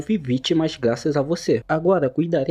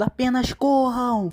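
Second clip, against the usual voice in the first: yelling; 8 semitones higher, 3 dB louder, slower than usual. Acted.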